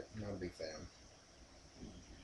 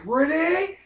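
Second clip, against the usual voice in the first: high-pitched; 12 semitones higher, 4 dB louder than usual. Retold or imitated. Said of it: "he's like".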